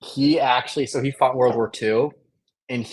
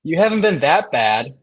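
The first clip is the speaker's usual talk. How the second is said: sarcastic tone; 4 semitones higher, 4 dB louder than usual. Acted. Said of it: "there is a tone there".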